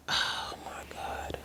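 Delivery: breathless whispered disbelief. Real, not acted. Reviewer stunned by the small box, whispering. Sees skinny box, despair.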